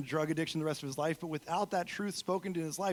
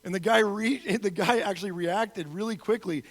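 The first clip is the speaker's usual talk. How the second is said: ordinary talk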